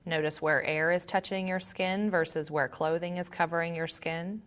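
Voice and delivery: calm, quiet meditation voice with long pauses; guided meditation. Acted. Yoga teacher leading students to notice body and breath, calm guided meditation. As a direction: guide them gently inward, leaving long silences.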